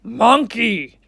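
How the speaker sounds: king's voice. Acted. King Lion calling the monkey, booming. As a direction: summon him like a stern king. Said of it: "said the king".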